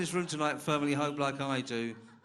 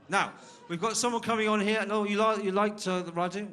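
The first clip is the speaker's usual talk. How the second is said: calling out; 9 semitones higher, 8 dB louder than usual. Real, not acted. MC calling out to the crowd.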